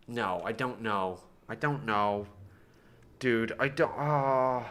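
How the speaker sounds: low, soft, resigned; defeated murmur. Real, not acted. Streamer stumped by the build, low defeated murmur. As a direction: let it sink out quietly, out of ideas. Stuck, giving up on ideas.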